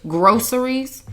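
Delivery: "groceries" said GROSS-ur-eez; "groceries" with a plain S in the middle. Shown as a demonstration. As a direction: make "groceries" start like the word gross. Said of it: "I don't like it like that".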